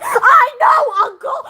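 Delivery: high, shouted retort to her uncle; young cat shouting at her uncle; defiant shout. Retold or imitated. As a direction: yell it back at him, proud and defiant. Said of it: "She's like".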